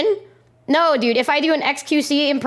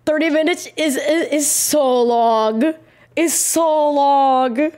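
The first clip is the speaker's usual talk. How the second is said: drawn out; 2 semitones higher, slower than usual. Real, not acted. Groaning at thirty minutes.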